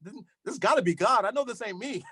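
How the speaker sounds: high, fast burst of self-surprise that settles; retelling his own surprised reaction; amazed at himself. Retold or imitated. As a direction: marvel at your own words, half joking. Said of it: "I'm just like".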